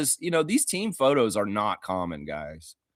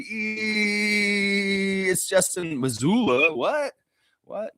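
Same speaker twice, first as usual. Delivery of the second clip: mock surprise; 8 semitones higher, much slower than usual. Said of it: "You're kinda like".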